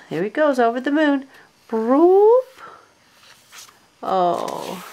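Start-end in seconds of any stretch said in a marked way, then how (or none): 1.7-2.6 high loud silly jumping noise; playful high jump sound effect; boing sound. Pretend. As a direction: pop out a bouncy cartoon sound. Jump over the moon noise.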